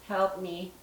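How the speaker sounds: soft, hushed imitation of a woman's plea; woman whispering. Repeating the ghost's plea from the device, hushed. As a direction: breathe it quietly like a faint ghost. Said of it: "a woman whispering".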